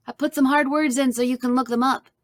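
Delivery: usual speaking voice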